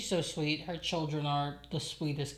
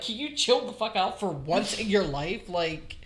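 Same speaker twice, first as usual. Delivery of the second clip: higher, louder exasperated scolding; fed up, demand he chill out; exasperated mom; 5 semitones higher, 7 dB louder than usual. Retold or imitated. Mom scolding her son, exasperated and louder. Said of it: "I just look at him".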